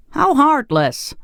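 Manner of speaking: high, slow, shocked outcry. A narrator's reading. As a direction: gasp it out in horror. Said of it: "greatly shocked".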